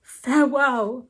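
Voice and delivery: loud, melodramatic warning slowing to a farewell; emphatic cry. A narrator's reading. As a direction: denounce emphatically like a jilted lover. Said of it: "he cried emphatically".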